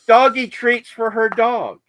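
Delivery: high, loud and slow, savouring the reveal; announcing the punchline to his co-host with delight. Real, not acted. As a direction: land the joke big. Punchline of the story.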